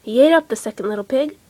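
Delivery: plain reading voice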